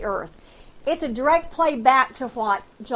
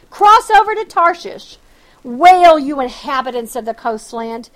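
loud scripture proclamation; 9 dB louder than usual. Acted. Preacher calling Tarshish to wail, loud proclaiming reading. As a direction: call out the verse, louder.

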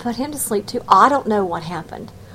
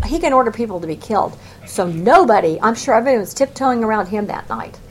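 ordinary delivery

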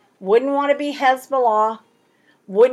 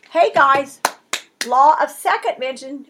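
ordinary talk

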